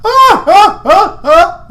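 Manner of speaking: high, loud forced ha-ha; nervous laugh. Acted. Lance laughing nervously at the door, high and loud. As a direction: laugh to cover nerves. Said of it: "clearly showing his nerves".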